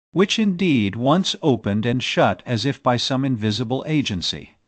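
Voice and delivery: plain reading voice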